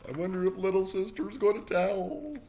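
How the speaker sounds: high sing-song aside; teasing suspense. Acted. Reader wondering aloud to listeners about sister, teasing. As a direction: lift it high, drawing out the end.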